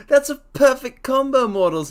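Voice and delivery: high and loud, still laughing; thrilled squeal at chat; excited laughing. Real, not acted.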